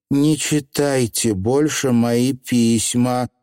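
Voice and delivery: ordinary delivery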